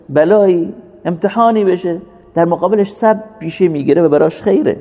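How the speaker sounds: high, measured sermon cadence with stretched Arabic phrases; impassioned preaching. Real, not acted. Preacher telling the congregation all is good for the believer, impassioned preaching. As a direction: proclaim the hadith slowly, pitched well up.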